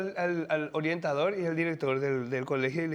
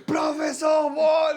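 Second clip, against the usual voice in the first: slow, loud, high shout; 8 semitones higher, 6 dB louder, much slower than usual. Retold or imitated. Classmates shout at the teacher, slow and loud.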